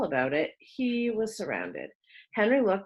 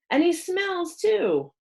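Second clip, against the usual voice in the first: slow, higher mocking kid; sneering jab; 11 semitones higher, 4 dB louder, slower than usual. A narrator's reading. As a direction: drag out the jab to wound. Fat boy adds insult.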